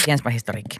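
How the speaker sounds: "historiikki" low and soft, falling; low aside. Real, not acted. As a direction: drop it to a quiet echo. Same title echoed as aside.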